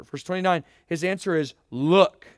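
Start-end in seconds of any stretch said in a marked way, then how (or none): 1.7-2.3 a louder, curt annoyed "Look."; retold brother's sharp retort; irritated look. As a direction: snap the one word at your father. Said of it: "It's not a happy tone".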